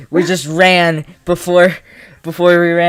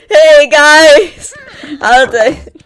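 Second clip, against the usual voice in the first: loud, high, over-bright hello through laughter; fake awkward greeting; 13 semitones higher, 9 dB louder, much slower than usual. Reader acting out a faked awkward smile, strained greeting. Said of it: "my awkward smile that's totally faked".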